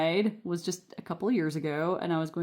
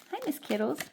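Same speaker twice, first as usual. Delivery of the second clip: cat greeting; 7 semitones higher, 3 dB quieter, faster than usual. Real, not acted.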